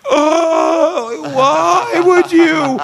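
high, drawn-out hammed-up wail; over-the-top anguished cry, stretched long; mock wailing. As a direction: imitate a distraught prank victim crying out. Retold reaction of pranked victim.

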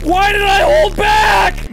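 high, loud, slow despairing yell; anguished shout. Real, not acted. Gamer missing the last jump, shouting in despair. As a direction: wail it out in regret.

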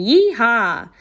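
rowdy yell. Acted. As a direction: whoop like a drunk cowboy. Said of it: "someone in the living room yelled".

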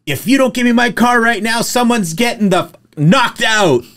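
imitating a furious customer, high-pitched threat; mock angry yelling. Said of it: "yelling at me".

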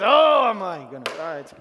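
loud exasperated grumble, eyes rolling; mock grumbling. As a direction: huff and complain like a fed-up spouse. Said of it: "That's grumbling".

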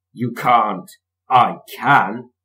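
loud bickering; arguing. A narrator's reading. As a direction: snap back in a quarrel. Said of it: "quarrel between bus and engine".

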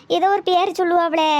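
normal talking voice

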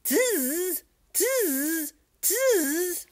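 very high monkey squeal; high-pitched storybook monkey cry; chattering monkeys. Acted. Monkeys mimicking the peddler in story.

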